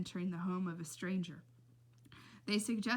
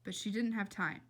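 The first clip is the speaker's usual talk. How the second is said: ordinary delivery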